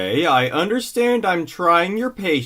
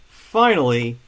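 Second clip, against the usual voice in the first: normal talking voice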